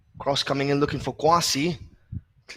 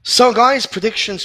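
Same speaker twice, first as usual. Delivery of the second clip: excited call out; 10 semitones higher, 10 dB louder than usual. Real, not acted. Commentator calls out to viewers.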